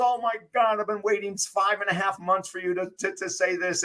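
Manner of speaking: high-pitched gushing relief; imitated overjoyed freak-out. Woman answers long-awaited marriage proposal, excitedly freaking out. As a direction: gush as the thrilled girlfriend. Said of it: "she's freaking out".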